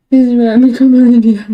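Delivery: loud, fast, half-laughing self-mockery; real laughing exasperation at her own mistake; laughing at herself. Real, not acted. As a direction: blurt it out through laughter.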